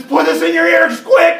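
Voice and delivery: put-on high, loud panicked kid's shout; crying. Kyle hands over gum against the sound, panicked crying shout. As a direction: scream it in tearful urgency. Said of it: "that's when Kyle starts crying".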